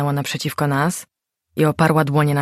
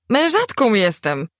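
higher, slower, stress on Mężatką; excited shout; 7 semitones higher, slower than usual. A narrator's reading. Narrator repeats the news to Gośka, excited and slowed. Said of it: "krzyknęłam podekscytowana".

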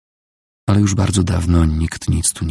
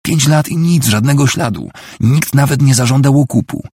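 loud, high, heated argument; exasperated outcry; desperate shouting; 7 semitones higher, 5 dB louder than usual. A narrator's reading. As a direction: hammer it home at raised pitch. Krzyknęła Nicole.

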